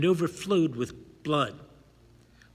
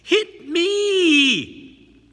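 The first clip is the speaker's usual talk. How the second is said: nasal whining cry; 13 semitones higher, 10 dB louder than usual. A narrator's reading. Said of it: "Nasal, whining, outcrying".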